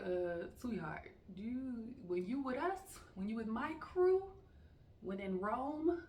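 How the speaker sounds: building from slow and sweet to high and loud; sweet at first, then rising into a firm scold; sassy teacher warning. Retold or imitated. Teacher scolding a new student, building sassy. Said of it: "So I'm like".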